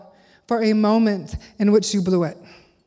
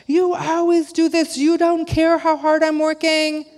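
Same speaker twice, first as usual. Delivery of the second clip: higher, slow, nagging reproach; mock angry rant; 7 semitones higher, slower than usual. Mom reenacting her outburst at her son, mock angry. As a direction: replay the mom's tirade, pitched up and dragged out. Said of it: "I was cursing him by saying things like".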